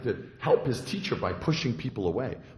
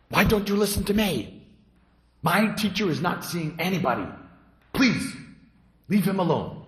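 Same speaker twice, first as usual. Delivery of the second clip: raised, higher voice of an angry attendant; 7 semitones higher, 4 dB louder than usual. Acted. Attendant angrily turning away the visiting monk at the gate. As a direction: push the guest away, getting heated. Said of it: "got very angry".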